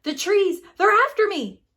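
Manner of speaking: high, loud panicked yelling; terrified shout. Acted. Papa screaming in his nightmare about the chasing trees. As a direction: yell like you're being chased. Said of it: "cried terrified Papa".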